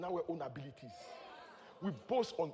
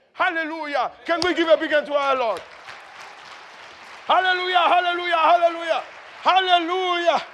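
rousing praise shout; 9 semitones higher, 12 dB louder than usual. Real, not acted. Preacher calling the congregation to applaud the Lord, rousing shout.

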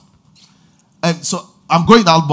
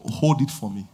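put-on soft, low reassurance to a child; calm the boy gently, softer; a father quieting his son, softer and lower; soothing father; 5 semitones lower, 9 dB quieter than usual. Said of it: "I say".

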